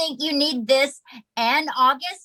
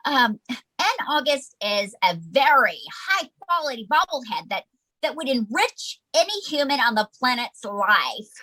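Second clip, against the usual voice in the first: ordinary talk, nothing marked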